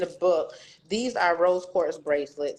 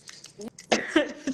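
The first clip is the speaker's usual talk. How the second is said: nervous laugh; 7 semitones higher than usual. Real, not acted. Calming down in public.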